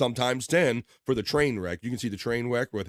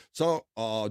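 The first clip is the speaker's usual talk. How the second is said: usual speaking voice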